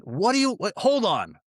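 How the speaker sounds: high mock voice, cutting himself off; flustered landlord. Landlord telling the caller to hold on, high mock voice. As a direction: pitch way up, confused and hurried.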